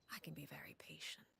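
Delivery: a soft, unvoiced whisper; menacing whisper. The Knave warns the travelers about the scheming children in a whisper. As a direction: let the threat hang quietly.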